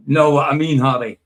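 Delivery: loud, lightly voiced Frank Bruno catchphrase; Frank Bruno impression. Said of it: "in Frank Bruno voice".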